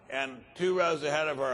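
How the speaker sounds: ordinary talk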